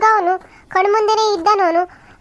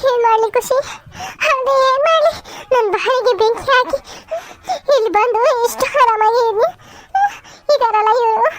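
tearful wail, pitch raised; crying; 5 semitones higher than usual. Acted. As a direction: sob the words out in despair. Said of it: "ಯಾಕೆ ಅಳ್ತಿದ್ದೀರಾ?". (ಅಳುತ್ತಾ ಹೇಳುವುದು)

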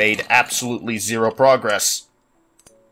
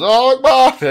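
high, loud hammed-up battle cry; mock suicide bomber yell; 13 semitones higher, 6 dB louder than usual. Creeper replacement sound idea, as a high hammed-up battle cry. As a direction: scream it much higher and louder. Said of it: "he just goes".

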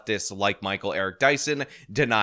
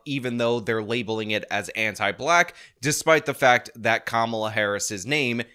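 ordinary delivery

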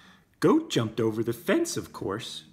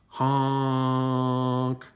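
a long held horn honk; 5 dB louder than usual. Acted. Stuck dump truck calling for help, a long honk.

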